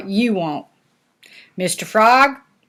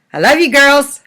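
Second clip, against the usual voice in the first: louder, fond farewell; loving goodbye; 4 semitones higher, 6 dB louder than usual. Real, not acted. Nana saying goodbye to the girls, fond and loud. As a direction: send them off with love. Grandmother signing off to granddaughters.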